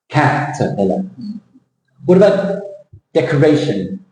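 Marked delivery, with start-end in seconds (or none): 0.1-1.0 low CAT-er-pillar, falling away; stressed first syllable. Shown as a demonstration. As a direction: hit CAT in "Caterpillar", shrink the rest. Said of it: "they're recessive".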